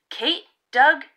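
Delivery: plain reading voice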